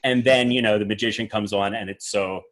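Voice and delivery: ordinary talk, nothing marked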